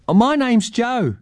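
put-on high, friendly child's voice; young girl. A narrator's reading. Joe gives her name to the old man, high child's voice. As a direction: introduce yourself as a lightly voiced young girl.

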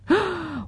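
high sudden intake of breath; startled gasp, half-mocking; sharp gasp. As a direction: gasp as if struck by a thought. Sudden thought of face creams.

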